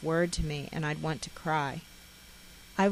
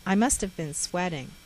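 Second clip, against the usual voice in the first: ordinary delivery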